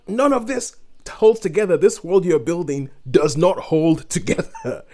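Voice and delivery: exasperated disbelief. Real, not acted. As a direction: throw up your hands at the nonsense. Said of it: "and I'm like".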